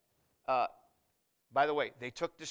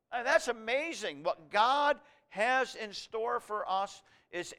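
raised, higher-pitched pulpit proclamation; impassioned preaching; 6 semitones higher, 8 dB louder than usual. Real, not acted. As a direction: proclaim the resurrection with mounting excitement. Preaches the coming resurrection with force.

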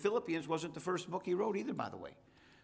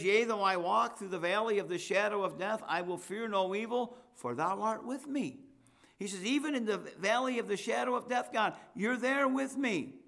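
higher and louder, building to a ringing climax; fervent preaching; 6 semitones higher, 3 dB louder than usual. Acted. Preacher driving home the valley of death verse, fervent and building. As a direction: preach it with rising fire.

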